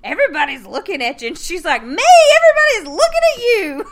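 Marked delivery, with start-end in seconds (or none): 1.8-3.8 much higher and louder, laughing through it; loud retort. Retold or imitated. Rose snaps back at her boyfriend, loud and high, laughing. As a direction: fire it back loudly, half laughing. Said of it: "talking loud to him".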